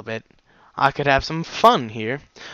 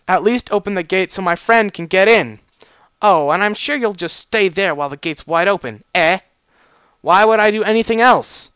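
loud, higher argumentative back-and-forth; heated exchange; 7 semitones higher, 7 dB louder than usual. Acted. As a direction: argue your case hard.

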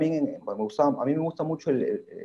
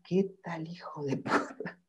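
soft, slow, higher incredulous mutter; muttered disbelief; 5 semitones higher, 10 dB quieter, slower than usual. Retold or imitated. Watching the subway singer, a soft slow astonished mutter. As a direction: marvel at him under your breath.

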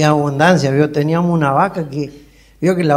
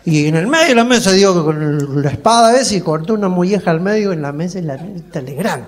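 ordinary delivery

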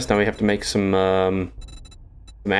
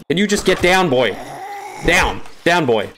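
commanding a dog; 7 semitones higher than usual. Pretend. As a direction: order it down like a dog.

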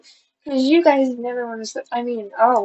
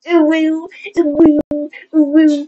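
loud, higher playful cat cries; acting like a cat; 4 semitones higher, 6 dB louder than usual. Vlogger acting like a cat, loud playful cries. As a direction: repeat short, loud make-believe cat calls. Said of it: "I act like a cat".

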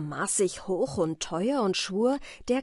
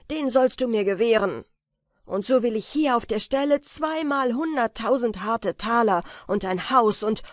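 laut und höher, triumphierend fordernd; gieriges Schreien; 4 semitones higher, 7 dB louder than usual. Acted. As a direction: packt ihn und schreit seinen Wunsch heraus. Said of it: "und schrie". (Peter schreit dem gepackten Männlein seinen Wunsch laut ins Gesicht)

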